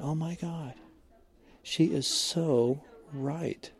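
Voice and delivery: hushed, slowing, as if just realizing; retold dawning realization. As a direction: drop your volume and let it sink in slowly. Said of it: "much later I thought about it".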